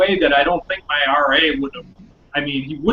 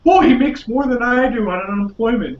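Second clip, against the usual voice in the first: high-pitched, sarcastic put-on awe; mock intimidated; 7 semitones higher than usual. Friend mocking the girlfriend's reaction, mock intimidated. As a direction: play at being intimidated, pitched up. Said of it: "very intimidated by that".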